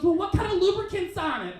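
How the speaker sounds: high-pitched pressing question; high imitation of a suspicious woman; insistent woman. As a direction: put on a high voice for a demanding date. Woman presses him, retold.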